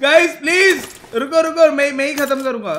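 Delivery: high, loud begging to wait; urgent loud plea. Real, not acted. Gamer begging teammates to leave the kill, urgent loud plea. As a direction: plead loudly with the squad.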